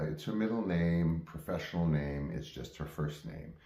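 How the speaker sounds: ordinary delivery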